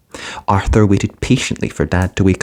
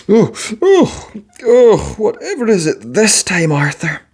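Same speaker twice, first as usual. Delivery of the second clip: higher, weary oh-oh-oh of a woken father; groggy Dad, mildly exasperated; 12 semitones higher than usual. A narrator's reading. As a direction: sigh it out, woken yet again.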